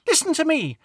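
put-on high, bossy little girl's call; little girl Lucy calling out. A narrator's reading. Lucy calls the local kids to attention, high and bossy. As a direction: call the kids over like a small queen. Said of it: "shouted eight-year-old Lucy".

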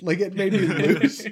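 helpless laughing at your own story; cracking up. Real, not acted. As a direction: lose it laughing while talking.

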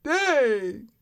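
high, mock-crying whine about being poor; mock whiny lament. Broke homebuyer whining about money, mock crying whine. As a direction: wail it as a hammed-up complaint. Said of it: "And it was like".

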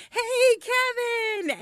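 high, drawn-out call to a neighbor; yelled greeting. Retold or imitated. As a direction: sing out a long hello from a car. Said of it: "so we yelled to him".

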